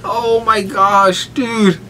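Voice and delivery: slow, high, laughing sigh; real fading laughter; winding down laughing. Real, not acted. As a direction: catch your breath after laughing. Recovering from laughter.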